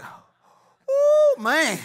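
high, loud whoop of amazement; whoop, then exclaim in wonder; thrilled reader. Acted. Preacher acting out reading Acts, thrilled.

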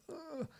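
small, high, quiet "ugh"; mock strained groan. Singing coach mocking odd vocal exercises, soft high strained groan. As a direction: squeeze out a tiny high grunt in imitation.

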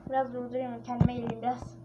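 usual speaking voice

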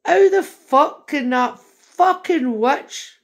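slow, loud, disgusted rant at the witch; angry outburst. Retold or imitated. Ranting to Elon about a woman on Twitter, slow and loud disgust. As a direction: spit it out slowly in outrage. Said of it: "I'm going".

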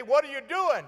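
high-pitched call across a distance; hollering. Retold or imitated. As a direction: call out as if testing an echo. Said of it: "you could holler".

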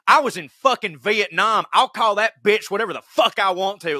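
high-pitched, defiant veteran's retort; imitated loud outburst. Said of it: "as loud, as loud as he could".

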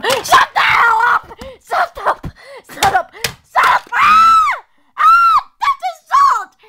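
very high screaming tantrum, played; mommy's road rage. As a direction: shriek like a mother losing it in traffic. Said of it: "Mommy's scary when she's in road rage".

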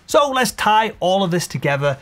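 ordinary talk, nothing marked

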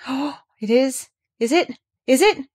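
high, breathless wonder; gasped. A narrator's reading. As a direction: gasp it out, thrilled and disbelieving. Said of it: "gasped Colin".

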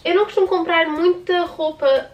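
ordinary talk, nothing marked

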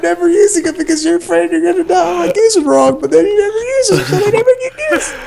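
very high falsetto rant, near laughing; exasperated squeal. Real, not acted. Player mocks friend's fear of using items, squealed exasperation. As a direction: squeal the complaint, half laughing half wailing.

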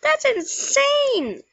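amazed. Real, not acted. Amazed at own luck.

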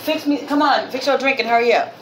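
high, quick parental order. Real, not acted. Parent hurrying a child along.

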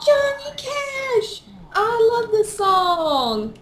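high, drawn-out delighted cry; excited exclamation. Real, not acted. Player hears a [name] song, excited. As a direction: stretch it out in high-pitched delight.

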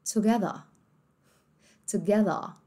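"Together" ending in a soft a, no r; British together. Shown as a demonstration. Teacher showing students the British ending. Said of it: "num sotaque mais britânico".

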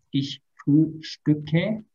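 Ich frühstücke stretched out slowly; slow demo. Shown as a demonstration. Teacher conjugating for students, slow and careful. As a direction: spell out the long verb slowly. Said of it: "es solamente largo".

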